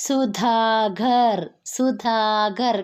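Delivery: Sudhakar read very slowly, syllable by syllable; slow sounding out. Shown as a demonstration. Teacher reading a word aloud slowly for students. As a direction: stretch out Sudhakar so the class can follow. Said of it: "ഇവിടെ എഴുതുന്ന വാക്ക് വായിച്ചു നോക്കൂ".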